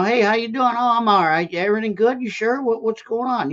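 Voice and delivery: friendly Minnesotan small talk. Retold or imitated. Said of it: "you didn't blab".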